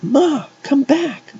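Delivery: very high, pleading call. A narrator's reading. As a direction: call mother back desperately. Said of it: "Heather called".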